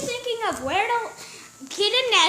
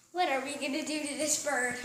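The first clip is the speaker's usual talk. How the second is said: ordinary delivery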